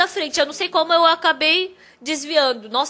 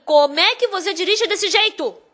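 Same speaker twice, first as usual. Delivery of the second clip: higher, indignant demand; confrontational; 4 semitones higher than usual. Retold or imitated. Bus driver confronting the man who followed him, indignant. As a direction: demand an explanation, voice raised in pitch.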